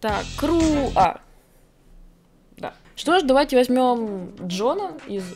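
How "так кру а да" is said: ordinary talk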